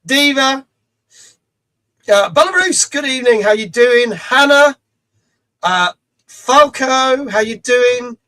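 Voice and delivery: bright, loud shout-outs to chat; loud greeting. Real, not acted. Streamer calling out viewer names with loud welcome.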